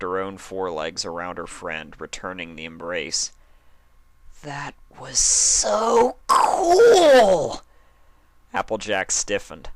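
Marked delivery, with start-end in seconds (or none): 4.4-7.6 much higher, louder, slow ecstatic cry; thrilled. Acted. Dash gushes to Applejack after the knife, loud and thrilled. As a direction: drag it out with thrill.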